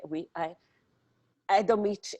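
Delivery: ordinary delivery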